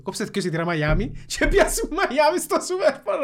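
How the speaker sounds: giddy spur-of-the-moment ticket request; excited laughing order. Retold or imitated. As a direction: blurt it out half laughing, thrilled. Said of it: "λαλεί του".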